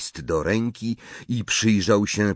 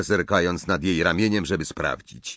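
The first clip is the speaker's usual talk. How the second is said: ordinary delivery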